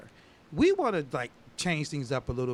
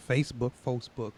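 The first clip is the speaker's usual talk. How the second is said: ordinary talk, nothing marked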